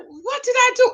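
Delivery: high-pitched baffled protest; mock innocent husband. Retold husband protesting innocence in quarrel.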